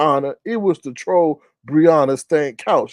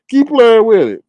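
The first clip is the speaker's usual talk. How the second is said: higher, louder repeated warning; emphatic warning; 8 semitones higher, 6 dB louder than usual. Real, not acted. Host warning viewers clicking on risky emails, emphatic. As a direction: drive the warning home with raised voice.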